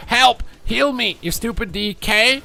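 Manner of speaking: very high, loud squeaky pet voice; yelling pet. Acted. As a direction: shriek at your careless owner like a whiny pet. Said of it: "My pet just yells".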